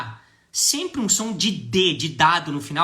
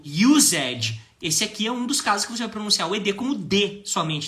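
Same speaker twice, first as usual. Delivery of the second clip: usual speaking voice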